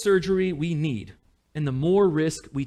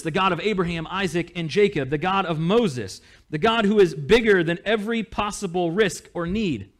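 ordinary talk, nothing marked

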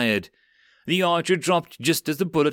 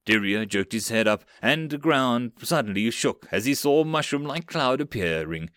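usual speaking voice

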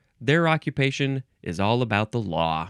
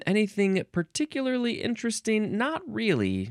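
much higher, light voice; high musing singsong; 9 semitones higher than usual. Pretend. Host checking the entry for interest, high singsong musing. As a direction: float it up high while scanning.

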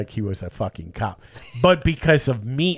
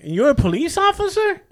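mock incredulous. Incredulous question to fake cop.

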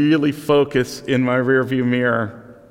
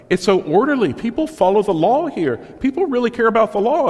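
impressed foreign student; 10 semitones higher, 3 dB louder than usual. Retold or imitated. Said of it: "one of the most common things you experience".

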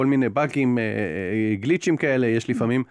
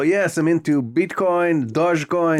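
higher and louder, drawn out slowly; eager crypto talk; 5 semitones higher, 3 dB louder, much slower than usual. Retold or imitated. Player winning over the Bitcoin character, eager and slow. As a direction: sell yourself as a crypto fan, stretching it. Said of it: "אני אומר לו".

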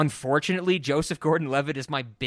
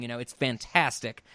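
ordinary talk

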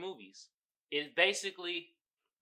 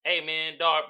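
usual speaking voice

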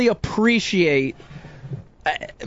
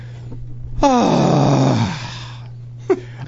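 dramatic sigh; 6 semitones lower than usual. Pretend. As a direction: heave a big exaggerated sigh of relief. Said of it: "let me go ahead and sigh for you live".

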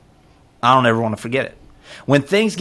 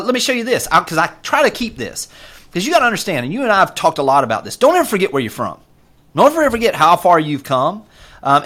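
normal talking voice

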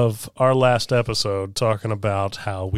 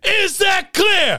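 drill sergeant; 4 dB louder, slower than usual. Acted.

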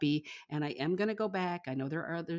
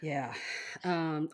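normal talking voice

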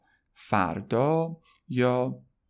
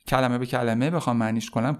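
ordinary delivery

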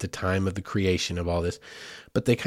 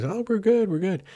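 sing-song high reply, lightly voiced; cheery high hikers; 11 semitones higher than usual. Hikers passing in the rain answer, sing-song and high. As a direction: toss back a breezy high answer.

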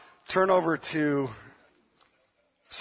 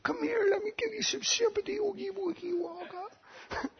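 tender sympathy; 12 semitones higher than usual. Retold or imitated. Father comforting his crying teenage daughter, tender baby talk. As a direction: coo to a hurt child. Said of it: "I'm like".

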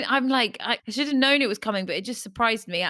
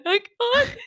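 mock sobbing. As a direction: wail it like a wreck mid-movie, half laughing. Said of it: "just like".

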